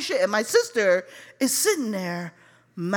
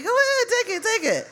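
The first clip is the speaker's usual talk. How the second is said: high-pitched waving-away voice; mock dismissal; 12 semitones higher, faster than usual. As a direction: wave it off in a high voice. Mock dismissal of his weed.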